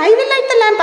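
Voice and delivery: high, lilting I will light the lamp; gentle Swami. Retold or imitated. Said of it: "Swami very nicely".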